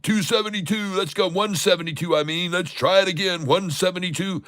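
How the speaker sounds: high, pumped-up calling of order prices; rally yourself over a filled order; real excitement, pitched high; excited trader. Real, not acted.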